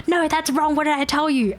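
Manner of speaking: high, scolding "no, that's wrong"; mock scolding trainer. Trainer snapping at the coworker on the call, mock harsh. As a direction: cut her off like a cross parent. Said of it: "And then she's like".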